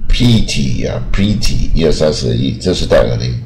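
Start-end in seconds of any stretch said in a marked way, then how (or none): 0.1-1.7 "pretty" as long "pree-tee"; "pretty" wrongly given an ee vowel; mispronounced. Shown as a demonstration. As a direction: stretch "pretty" with a tense ee. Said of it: "念错是念一二三四的一".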